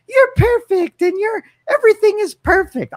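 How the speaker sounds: exaggerated falsetto flattery; gushing girlfriend. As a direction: gush in a high-pitched put-on voice. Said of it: "I'm not your little girlfriends are gonna say".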